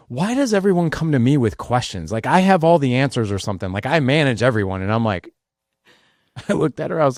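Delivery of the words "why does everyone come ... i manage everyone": exasperated wife. Retold or imitated. Wife snapping at husband, stressed.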